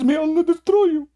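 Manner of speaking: very high, wailing curse; hammed-up crying curse; mock wailing curses. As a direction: whine out the curses like a crying man. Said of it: "He says".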